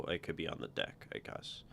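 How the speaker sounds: usual speaking voice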